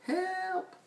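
cry for help. A narrator's reading. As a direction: call for help weakly and high. Beast calls for help in story.

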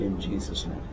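soft, reverent prayer voice; hushed blessing. Acted. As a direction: pray it quietly over the guest. Prayer close.

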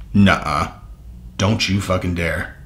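quiet slow threat at the press release; low quiet warning. Real, not acted. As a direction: warn the news under his breath. Hissed warning at the news.

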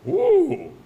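loud, high exclamation of mock awe; impressed "ooh". Mock awe at Torah scroll announcement. (קריאת התפעלות רמה וגבוהה)

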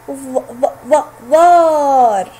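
ظ ظ ظ ظار built up syllable by syllable, very slowly; teacher sounding out ظار slowly. Shown as a demonstration. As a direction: sound out the letter then the syllable. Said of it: "جاء حرف الضاء في وسط الكلمة".

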